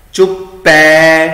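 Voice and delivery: ਚੁੱਪੈ slow and sung, open ai ending; dulavan ending. Shown as a demonstration. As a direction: sing out the ai in ਚੁੱਪੈ. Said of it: "ਚੁੱਪੇ ਨਹੀਂ ਪੜ੍ਹਨਾ". (ਚੁੱਪੈ ਦੁਲਾਵਾਂ ਨਾਲ)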